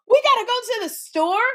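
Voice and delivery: high, confused question, dim and lost; the clueless echo, rising at the end; dumb blonde. Retold or imitated. Retelling her dumb blonde echo of Kim, high confused question. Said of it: "And then I had to go".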